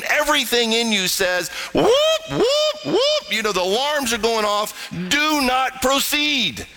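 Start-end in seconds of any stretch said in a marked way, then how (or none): 1.7-3.2 high siren whoops, like an alarm. Pretend. Your whole system warns you with a high alarm whoop. 5.1-6.7 alarm warning. Pretend.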